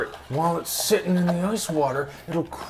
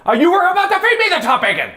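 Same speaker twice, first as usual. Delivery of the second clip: high, fast, laughing outcry; playful outraged accusation; 12 semitones higher, 4 dB louder, faster than usual. Real, not acted. Catching them with the top bacon, laughing accusation. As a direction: accuse at a quick high pitch, amused.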